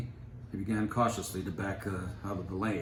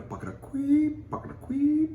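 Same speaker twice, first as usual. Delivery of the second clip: much higher, louder machine sound pucketa queep; machine noise; 9 dB louder than usual. A narrator's reading. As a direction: mimic a failing machine sputtering.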